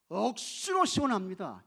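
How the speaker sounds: loud, punchy Gyeongsang imitation; Gyeongsang dialect. Preacher shows how Gyeongsang people say it, Gyeongsang dialect. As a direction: put on a Gyeongsang accent, punching 억수로. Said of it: "이렇게 이야기해요. 경상도 사람들은".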